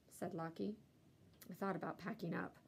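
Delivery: ordinary delivery, nothing marked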